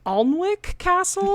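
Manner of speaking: "Alnwick Castle" said high and slow, mimicking the English way; Northumberland-style Alnwick. Shown as a demonstration. Podcaster mimics English locals saying the castle name, high and slow. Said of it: "I listened to fifty different people say this".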